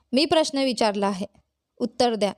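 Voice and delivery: usual speaking voice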